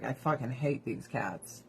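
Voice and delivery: low, quiet imagined dog's grumble; low mock grumble as a dog; voiced dog. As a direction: play the fed-up dog under your breath. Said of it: "This dog's like".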